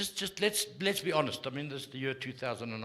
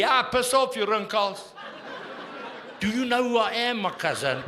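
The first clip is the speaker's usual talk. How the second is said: a cocky little meerkat yelling much louder and higher; angry meerkat; 8 semitones higher, 7 dB louder than usual. Acted. Meerkat shouting down a rival in front of family, loud and cocky. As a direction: puff up and shout him off like a tough little meerkat.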